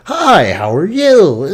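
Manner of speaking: dad's fake-ass greeting, slow and swooping high; fake customer-service voice. Son imitating dad greeting dry-cleaner customers, fake-ass voice. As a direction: draw it out in an over-the-top fake friendly voice. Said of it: "he had this fake-ass voice".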